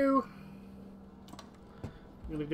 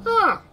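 high sharp cry of alarm; sudden startled yelp; 10 dB louder than usual. Real, not acted. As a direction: yelp as if jolted. Reacting to game events.

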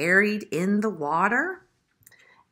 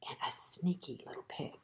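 whispering; 4 semitones lower, 16 dB quieter, faster than usual. A narrator's reading. Sneaky pig introduced, whispered.

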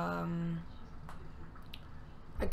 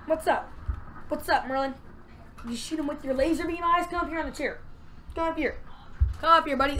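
higher-pitched greeting to a pet; pet talk; 5 semitones higher, faster than usual. Real, not acted. Player to pet that just came in, higher pet-talk. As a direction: coax a pet up onto the chair.

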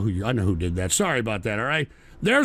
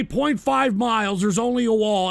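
usual speaking voice

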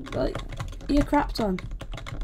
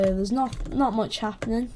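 usual speaking voice

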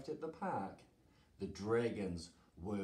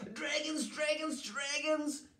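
very high, panicked townsfolk cry; high-pitched acted alarm; storybook scream. A narrator's reading. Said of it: "they screamed".

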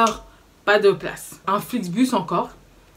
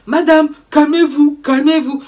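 mock police officer; 5 semitones higher, 8 dB louder, slower than usual. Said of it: "On me dit".

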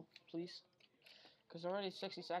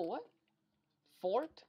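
usual speaking voice

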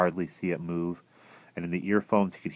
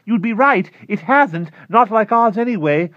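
much higher and louder, intense; tense high voice; 12 dB louder than usual. Acted. Klausner confiding in his neighbor, feverish. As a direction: lean in with a feverish secret.

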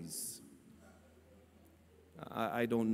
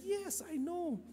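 high, soft startled answer; startled reply; 11 semitones higher, 9 dB quieter, slower than usual. Retold or imitated. Startled cashier answers preacher's question about Jesus, imitated surprise. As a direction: imitate a cashier caught off guard. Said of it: "she looked at me startled".

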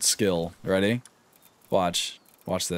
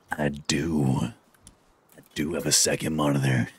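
deep put-on voice; 9 semitones lower than usual. Answering in a put-on voice.

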